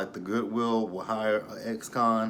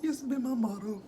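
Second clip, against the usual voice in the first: high, mocking replay of his line; amused replay; 12 semitones higher, 4 dB quieter than usual. Reviewer repeating the ex-con's bank joke. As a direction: squeak it back in disbelief.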